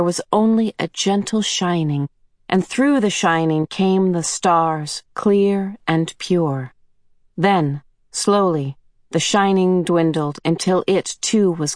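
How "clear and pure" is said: plain reading voice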